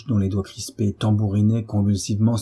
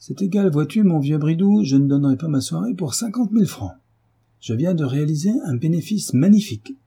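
higher, louder triumphant boast; gloating glee; 7 semitones higher, 3 dB louder than usual. A narrator's reading. Jacques boasts to Bridoux, higher and gloating. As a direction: crow over a secret win.

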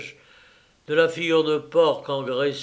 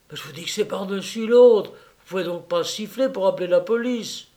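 fast, raised retort; heated retort; 6 semitones higher, 5 dB louder than usual. Acted. Purvis argues back at Rosenthal, fast and angry. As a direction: snap back in a rush. Quarrel reply.

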